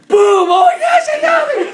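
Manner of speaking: very loud, high mock scream; wildly hammed-up excitement; shouted re-enactment. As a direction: burst out with the blast and a shriek. Replaying shot and excited reaction.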